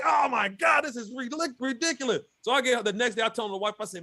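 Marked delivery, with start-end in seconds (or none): 0.0-2.2 exasperated outburst. Retold or imitated. Angler watching his rank fall, exasperated shout. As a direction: blurt it out as the rank keeps sinking. Dropping in the tournament rankings.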